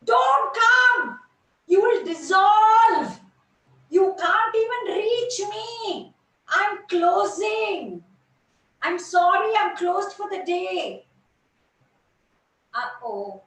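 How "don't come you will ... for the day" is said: loud, high, slow warning cry; screaming lotus. Acted. Lotus screaming at the dewdrop to stay away. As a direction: shout a frantic warning across the pond. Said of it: "the lotus screamed".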